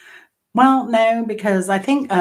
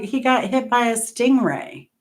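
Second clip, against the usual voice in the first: higher-pitched Australian imitation; retold Australian voice, pitched up; Australian accent; 7 semitones higher than usual. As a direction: lightly mimic an Aussie passing on news. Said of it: "they're Australian".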